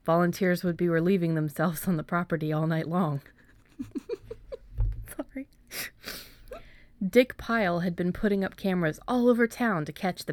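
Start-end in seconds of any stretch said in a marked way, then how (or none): 3.8-5.1 a quick stifled giggle; breathy giggle. Real, not acted. Reader cracks up at her own joke, quick stifled giggle. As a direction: break off the reading with a laugh. Reader breaks off laughing, apologizes.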